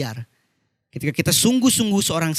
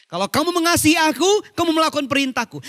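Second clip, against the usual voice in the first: higher, urgent scripture recital; preach; 10 semitones higher than usual. Real, not acted. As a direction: press the verse on the congregation. Preaches the verse with raised voice. (membacakan ayat dengan nada tinggi)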